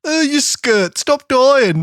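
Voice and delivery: high mocking bossy voice; mock film boss. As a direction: yell at him like a callous director. Mock yelling at sick actor.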